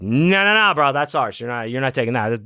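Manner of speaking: loud mock protest from a frat guy; retold angry objection. Frat man snatching his cup back, loud objection.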